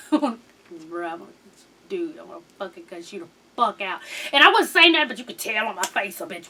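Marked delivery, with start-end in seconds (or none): 0.1-4.0 low, soft muttered threat; suppressed irritation. Retold or imitated. Singer fed up with husband's retakes, suppressed irritation. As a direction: hold the anger down under your breath.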